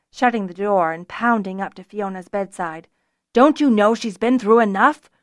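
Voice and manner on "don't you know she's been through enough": louder protective rebuke; angry scolding. A narrator's reading. Zennor rebukes Fiona at her bedside, angry. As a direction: scold forcefully.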